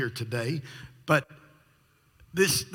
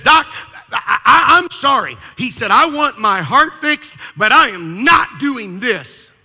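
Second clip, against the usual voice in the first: much louder and higher, fed up; fed-up patient rejecting the doctor's plan loudly; exasperated refusal; 9 semitones higher, 14 dB louder, slower than usual. Retold or imitated. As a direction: throw up your hands and refuse.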